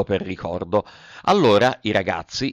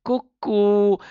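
much higher teasing cucù in a put-on voice; a higher, cheekier taunt; mocking cuckoo; 10 semitones higher than usual. A narrator's reading. Pinocchio mocking the boys' threat, a high teasing cucù. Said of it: "in segno di canzonatura".

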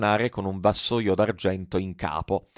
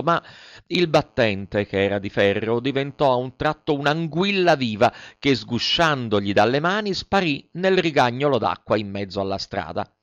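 ordinary delivery, nothing marked

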